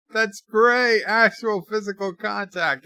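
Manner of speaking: high, loud thrilled exclamation; mock excitement. Streamer quoting the scientist's delight, excited. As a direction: gush over the discovery.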